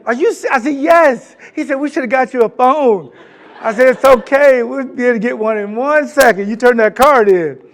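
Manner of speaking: high and loud, rushing; bursting to be drafted; excited call. Retold or imitated. Player assuring the coach over the phone, high and excited.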